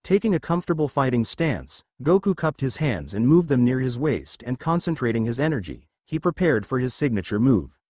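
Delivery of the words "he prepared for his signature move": ordinary delivery, nothing marked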